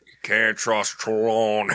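slow, put-on character voice; game character impression. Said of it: "what I always remember from Red Dead Two".

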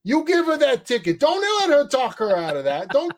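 truck driver yelling. Retold or imitated. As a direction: bellow it out the cab window like an angry trucker. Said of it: "sticks his head out the window and goes".

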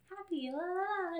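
high, soft, airy sing-song; light sing-song. Retold or imitated. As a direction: trill it lightly, high and softly. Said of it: "Normally I'm just like".

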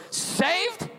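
shrill high-pitched "Saved?" in disbelief; amazed, rising one-word echo; surprised question. Retold or imitated. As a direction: imitate the stranger's startled question.

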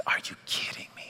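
whispered disbelief; exasperated whisper. Retold or imitated. Interrupted dad to himself at his desk, exasperated whisper. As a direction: hiss it under your breath, voiceless. Interrupted husband reacting under breath.

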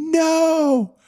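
sudden high cry of no; retold scream. Husband retelling wife's scream at bad news. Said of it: "you screaming".

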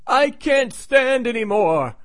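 high, drawn-out theatrical cry; mock yell. Man crashing through the wall, hammed-up yell.

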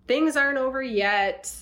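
ordinary talk, nothing marked

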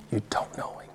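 barely voiced whisper. Preacher whispering a warning to the congregation. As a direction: let it fall to a whisper.

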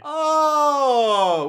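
a loud, high sudden "Oh"; surprised oh. Real, not acted. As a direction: jump up in recognition. Sudden recognition.

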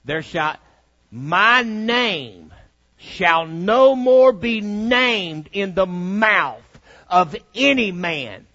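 slow, booming scripture reading; preaching. Acted. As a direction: proclaim it slowly and loud.

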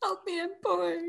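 high, laughing mock whine; Annie. Co-host echoing host's Bridesmaids quote, high mock plea. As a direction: echo the movie plea, hammed-up and giggly. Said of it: "identify with Annie".

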